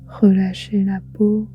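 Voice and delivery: ordinary delivery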